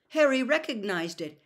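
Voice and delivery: ordinary delivery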